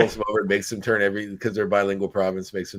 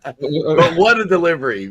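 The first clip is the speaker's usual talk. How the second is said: higher, louder, slow mock-awed praise; mock-grand praise; 9 semitones higher, 9 dB louder, slower than usual. Real, not acted. Host teasing guest about his one line, mock-awed praise. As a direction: savour it like a great performance.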